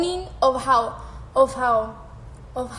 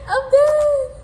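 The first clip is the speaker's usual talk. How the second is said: much higher and louder, squealed; laughing squeal; 13 semitones higher, 8 dB louder than usual. Real, not acted. Reacting to a Dominican slang comment, squealing with laughter. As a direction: shriek it through the giggles.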